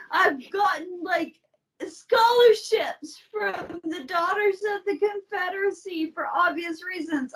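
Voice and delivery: laughing through words. Real, not acted. Confessing a Confederate scholarship, laughing helplessly. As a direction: barely get the words out laughing. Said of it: "I'm laughing at everything".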